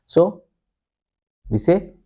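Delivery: normal talking voice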